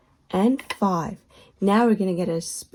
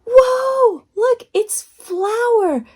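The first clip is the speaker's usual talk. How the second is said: excited wonder; 12 semitones higher, 5 dB louder, much slower than usual. Acted. Excited reveal of ingredient.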